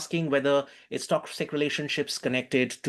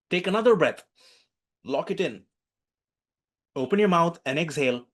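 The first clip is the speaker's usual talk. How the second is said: clear, raised coaching instructions; guided breathing; 3 semitones higher than usual. Acted. Teacher guiding the class through a breathing exercise.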